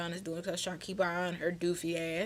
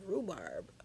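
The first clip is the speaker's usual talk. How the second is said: low, soft, unimpressed repeat of a name; deadpan disbelief; 6 semitones lower, 10 dB quieter than usual. Acted. Teller silently judging the man's name, low deadpan disbelief. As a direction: drop it low and quiet, incredulous. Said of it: "I'm just sitting there like".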